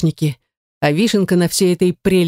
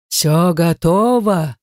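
slow demon rasp; much slower than usual. A narrator's reading. Demon asks servants.

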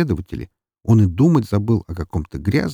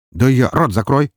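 louder, higher command to shut up; curt order; 5 semitones higher, 5 dB louder than usual. A narrator's reading. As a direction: cut him off with an order. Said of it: "приказал он".

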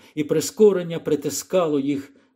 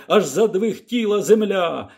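usual speaking voice

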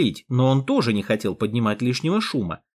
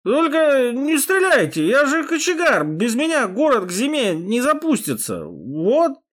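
put-on drunk voice; 10 semitones higher than usual. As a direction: whine for mercy like a drunk. Hero still playing drunk, pleading.

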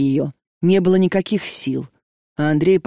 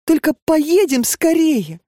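high, urgent coaxing mother; pleading mother; 7 semitones higher than usual. A narrator's reading. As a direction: coax her daughter to hurry. Mother urges her to come.

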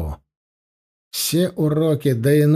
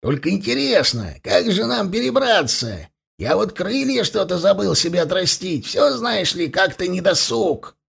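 higher-pitched mocking complaint; sarcastic grumble; 5 semitones higher than usual. A narrator's reading. Dwarf wondering how to cross without wings, sarcastic grumble. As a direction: needle him with mock wonder.

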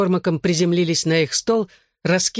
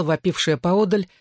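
ordinary delivery, nothing marked

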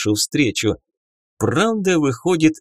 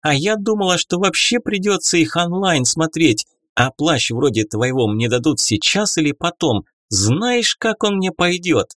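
put-on bubbly sorority girl; 7 semitones higher than usual. A narrator's reading. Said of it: "завизжала от радости эльфийка".

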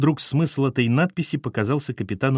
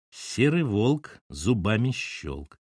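slow rhyme; much slower than usual. Acted. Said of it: "подумал капитан".

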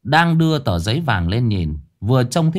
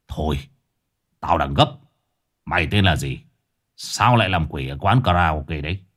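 lower-pitched brusque questioning; impatient old exorcist; 6 semitones lower than usual. Acted. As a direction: interrogate the ghost in a low voice. (giọng ông thầy già trầm, tra hỏi)

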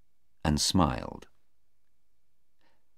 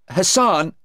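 HASSAN high and louder; upset witness; 8 dB louder than usual. Acted. Sarah naming Hassan to the lawyer, upset. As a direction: blurt out Hassan on a high pitch.

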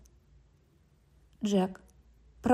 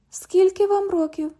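much higher, teasing repeat of the question; mocking echo; 7 semitones higher than usual. London echoes the cop's question, high and teasing. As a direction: echo his question to mock him.

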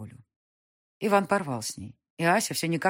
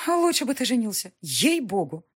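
презрительно; 8 semitones higher, 5 dB louder than usual. Acted. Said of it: "Презрительно фыркнула".

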